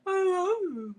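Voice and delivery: high, drawn-out doggy "I love you"; talking dog. Retold or imitated. Owner quoting her talking dog, high doggy voice. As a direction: imitate a dog crooning words up high. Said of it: "He said".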